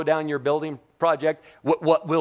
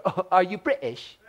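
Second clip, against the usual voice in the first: mock question through a laugh, higher; teasing, laughing; 5 semitones higher than usual. Americans laughing at a British word, teasing. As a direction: tease the Brit while laughing. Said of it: "We go".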